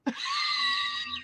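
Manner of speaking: squealing burst of laughter; high-pitched laughing shriek. Real, not acted. Laugh after retold reaction.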